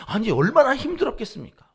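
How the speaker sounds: loud, high stress on 얼마나; pleading outcry. Retold or imitated. Preacher pleading for the priests, loud stress on 얼마나. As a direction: press hard on 얼마나 in protest. Repeated rhetorical plea.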